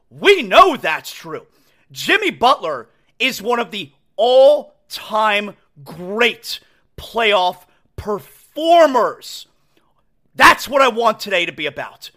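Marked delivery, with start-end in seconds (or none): 1.9-9.5 slow, whispered emphasis on great playoff performers; emphatic. Real, not acted. Host praising Jimmy Butler, slow and hushed. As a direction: lean in and savour each word.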